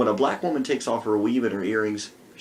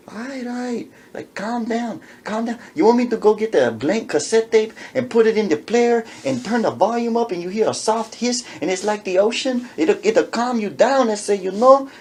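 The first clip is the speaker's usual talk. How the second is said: placating Chicano voice; 8 semitones higher, 3 dB louder than usual. Retold or imitated. Man voiced calming.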